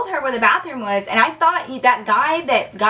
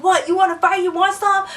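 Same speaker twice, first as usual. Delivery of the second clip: higher-pitched cocky fight challenge; mock challenge; 8 semitones higher than usual. Guy jumps up from the couch, mock challenge. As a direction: imitate a guy squaring up.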